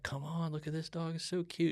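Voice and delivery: high, soft coaxing wife; cutesy pleading. Retold or imitated. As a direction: coo over a cute puppy. Wife quoted coaxing over puppy.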